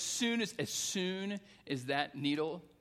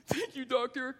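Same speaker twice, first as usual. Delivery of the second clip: put-on high-pitched child's forced thanks; dutiful little boy; 9 semitones higher than usual. Boy thanks doctor because he should, dutiful child voice. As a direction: imitate a boy thanking out of duty. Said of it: "'cause he should".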